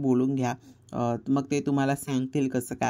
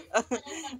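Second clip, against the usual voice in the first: real laugh; 9 semitones higher, 5 dB quieter than usual. Real, not acted. Laughing at child's answer.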